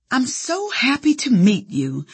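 Southern lady's sugary lilt; sweet Southern accent. A narrator's reading. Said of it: "in this sweet Southern accent".

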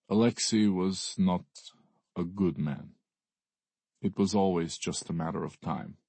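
lightly voiced Russian brother, solemn; somber Russian accent. A narrator's reading. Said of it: "he asks in a Russian accent".

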